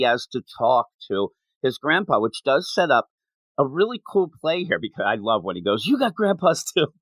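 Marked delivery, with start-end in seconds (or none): 5.9-6.9 put-on high, thrilled kid's cry; excited. Boy thrilled that she has grandpas, excited. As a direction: blurt it out delighted. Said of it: "He's all excited".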